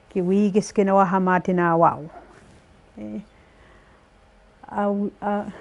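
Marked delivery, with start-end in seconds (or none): none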